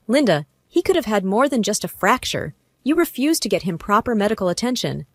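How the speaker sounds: plain reading voice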